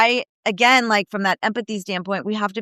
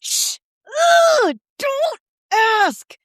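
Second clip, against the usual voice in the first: high, slow, mortified mother hushing; imitating a flustered mom shushing her kid; much slower than usual. Mom hushing her curious child, mortified high voice.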